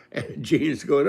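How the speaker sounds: ordinary delivery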